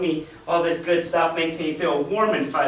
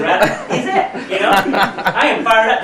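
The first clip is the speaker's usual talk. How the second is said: laughing; 2 semitones higher, 5 dB louder, slower than usual. Real, not acted. Laughing about his excitement.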